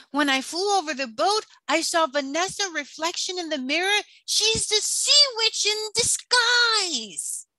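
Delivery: high, fast squawky seagull bursting with news; excited seagull voice. Acted.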